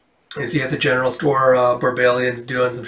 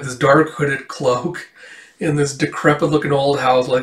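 ordinary talk, nothing marked

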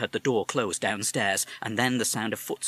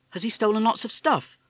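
put-on young boy; 12 semitones higher, 5 dB louder than usual. Boy asks mother.

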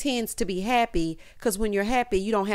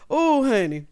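ordinary talk

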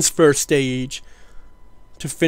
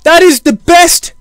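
full-throated victory shout, much higher and louder; triumphant yell; 12 semitones higher, 14 dB louder than usual. Real, not acted. Streamer celebrating his rocket's orbit to viewers, yelling. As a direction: bellow it like you just scored the winning goal. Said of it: "I'm sorry for yelling down the fucking microphone".

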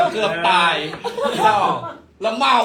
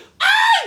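high piercing mock shriek at a picture; imitated shriek; 8 dB louder, much slower than usual. Friend shrieks at the crocodile picture, high mock scream. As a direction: scream as if scared of a photo. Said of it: "เปิดรูปเสร็จกรี๊ด".

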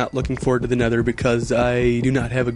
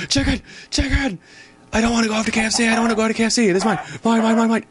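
much higher possessive squeal; high squeaky voice; 10 semitones higher, much faster than usual. Acted. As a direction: squeal like a greedy cartoon. Chasing chicken, squealing.

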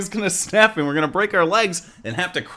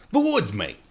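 put-on Australian accent.

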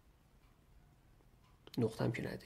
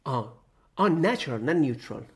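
sudden realization; 3 semitones higher, 9 dB louder than usual. Real, not acted. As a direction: catch your own misreading out loud. Catches own misreading of a word. (فهمیدن ناگهانی اشتباه خود)

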